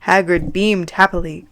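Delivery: plain reading voice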